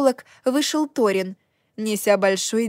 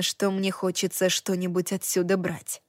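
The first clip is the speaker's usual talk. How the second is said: ordinary delivery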